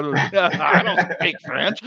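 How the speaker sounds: laughing. Real, not acted. Laughing at his own French trick, chuckling through the words.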